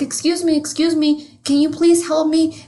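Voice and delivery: high-pitched imitation of a cartoon girl; Lisa Simpson. Lost girl asking two old men for directions, high imitated voice. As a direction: pitch it up as a lost child politely pleading. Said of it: "And she's like".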